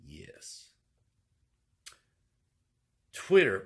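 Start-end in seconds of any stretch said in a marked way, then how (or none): 0.1-0.7 soft secret whisper. Real, not acted. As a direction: whisper the yes to yourself. Whispered answer to himself.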